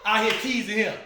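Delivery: high, loud, fast mock tirade; telling-off. Aunt confronts kids teasing nephew, high loud scolding. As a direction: imitate an aunt confronting a crowd. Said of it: "He said".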